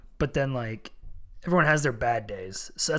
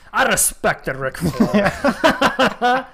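slow high drawn-out promo; mock wrestling promo; hammed-up promo; 6 semitones higher, 8 dB louder, much slower than usual. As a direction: drag it out like a wrestler.

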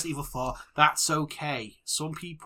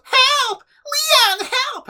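very high, loud falsetto shrieks; mock cry for help; 8 dB louder, much slower than usual. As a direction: imitate a helpless game character squealing for rescue. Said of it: "and one goes".

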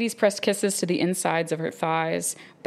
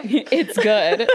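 laughing; 4 semitones higher, 6 dB louder, slower than usual. Real, not acted. Reading lines while laughing.

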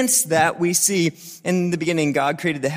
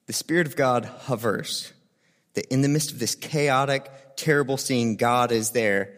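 hushed reverence; 8 semitones lower, 6 dB quieter than usual. Acted. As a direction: drop to a reverent hush.

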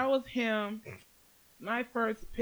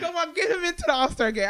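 excited; 10 semitones higher, 7 dB louder than usual. Real, not acted. Excited plea for Caruso.

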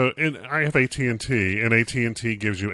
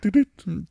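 beep imitation; 8 semitones higher than usual. Shown as a demonstration. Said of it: "I can't do the beeps like".

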